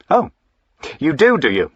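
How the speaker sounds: high, loud mocking challenge; scornful retort. Master scoffing at his servant's worry, loud and high. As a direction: throw his words back at him.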